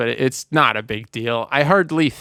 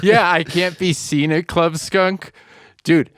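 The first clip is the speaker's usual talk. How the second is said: mock quote; 6 semitones higher, 4 dB louder than usual. Repeats quote in put-on voice.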